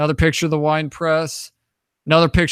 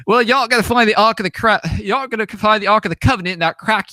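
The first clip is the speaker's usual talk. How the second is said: higher, louder teasing British guide; raised-voice imitation of a British tomb guide; British garden guide; 6 semitones higher, 3 dB louder than usual. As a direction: imitate the British guy ribbing you. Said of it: "you know, it's British".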